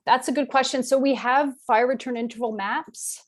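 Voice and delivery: usual speaking voice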